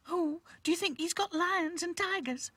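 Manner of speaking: very high, timid doll voice; nervous. A narrator's reading. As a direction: ask it nervously, pitched right up high. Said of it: "asked Lucy nervously".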